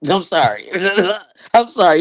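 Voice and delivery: laughing apology. Real, not acted. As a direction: giggle the apology out.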